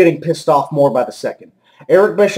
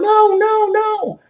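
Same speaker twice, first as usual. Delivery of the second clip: very high falsetto wail; squeaky, drawn-out mock panic; mock pleading; slower than usual. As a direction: imitate her squealing in protest. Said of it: "She's going".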